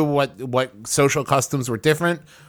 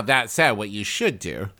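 ordinary talk, nothing marked

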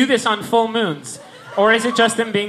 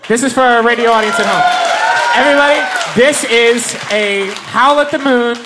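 announcer shout; 3 semitones higher, 6 dB louder than usual. Acted. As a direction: present it to the crowd at full voice. Announcing to the radio audience.

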